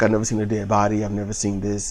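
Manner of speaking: low, soft, imitated shock; imitated stunned reaction. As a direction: drop your voice as if stunned by a body. Voices girl seeing a dead body.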